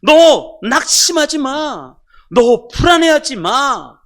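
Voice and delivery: loud, high, slow rebuke; forceful acted telling-off; stern scolding. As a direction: thunder it at your own soul, stretching each command. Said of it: "이렇게 호통을 치는 거예요".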